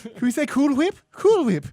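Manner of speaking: Cool Whip said in a high squeaky voice; high mock voice. Mocking Cool Whip pronunciation.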